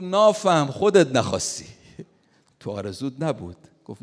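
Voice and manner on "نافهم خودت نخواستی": put-on Mashhadi accent, much higher, loud rebuke; loud scolding. Old master scolds his disciple loudly, Mashhadi accent. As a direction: snap it at the foolish disciple, loud.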